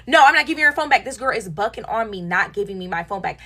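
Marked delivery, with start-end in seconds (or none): 0.1-1.1 imitating a girl's higher, louder, fast refusal; mock her snapping no at you; mimicked defiant no, high and quick; stubborn refusal. Friend refusing to return phone.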